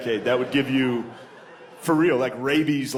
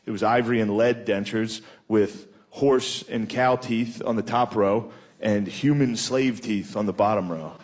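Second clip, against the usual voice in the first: ordinary delivery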